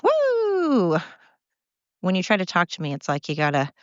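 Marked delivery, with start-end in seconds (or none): 0.0-1.0 high woo called out playfully; excited whoop. Retold or imitated. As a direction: let out a burst of a cheer. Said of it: "I'm like".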